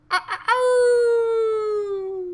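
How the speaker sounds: high, loud, drawn-out howling call; wolf howl. Acted. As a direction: howl it out across the wilderness. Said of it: "my terrible call".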